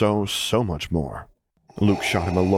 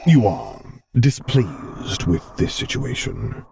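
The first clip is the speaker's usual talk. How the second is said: careful Vader; 5 semitones higher, much slower than usual. A narrator's reading. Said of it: "he noted carefully".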